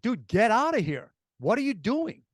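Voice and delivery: retold demand with pitch pushed up; indignant confrontation. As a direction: order the intruder off, mildly acted out. Confronting intruder in retold scene.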